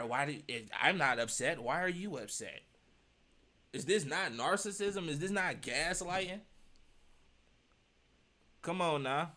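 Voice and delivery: ordinary talk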